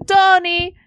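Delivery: high, loud call in a put-on Latin accent; Maria. Maria calling after Tony as he leaves, mock Latin accent. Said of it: "He said in a thick Latin accent".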